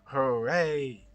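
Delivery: big, loud hooray with extra voice; overdone celebratory shout; loud hammed-up cheer. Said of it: "put some more voice into this".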